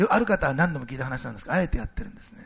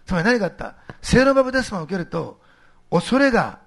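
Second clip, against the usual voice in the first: emphatic, raised sermon voice; impassioned preaching; 5 semitones higher, 6 dB louder than usual. Real, not acted. Preacher tells congregation how fear changed, impassioned preaching. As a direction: press the argument with rising conviction.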